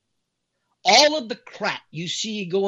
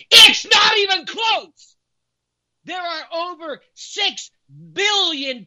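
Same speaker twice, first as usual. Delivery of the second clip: "it's not even close" shouted high and slow with a crying edge; 6 dB louder, slower than usual. Real, not acted. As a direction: break out into a slow anguished shout. Warning of vaccine deaths.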